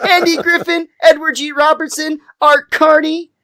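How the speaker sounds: very high indignant listing; hammed-up high-pitched name list; defensive rant. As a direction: reel off examples in mounting protest. Same acted rant, listing examples.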